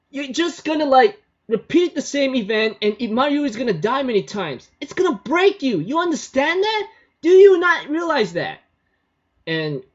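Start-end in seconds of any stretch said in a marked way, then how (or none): 0.1-8.6 put-on much higher, louder girl's voice, scolding hard; Kurisu scolding. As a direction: voice Kurisu way up high, pleading and scolding. Kurisu scolds Okabe in quarrel.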